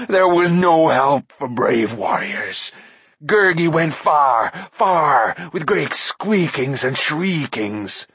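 slow, whiny, groveling beast voice; fawning creature. A narrator's reading. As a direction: grovel to the mighty lords. Gurgi's creature voice.

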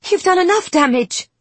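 high, strained, near breaking; wavering upset voice. Acted. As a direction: let it almost crack. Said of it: "Wren's voice has a slight warble to it".

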